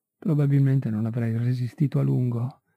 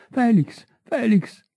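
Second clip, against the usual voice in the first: shouting; 8 semitones higher, slower than usual. A narrator's reading. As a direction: scream his name in sudden panic. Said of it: "all'improvviso gridò".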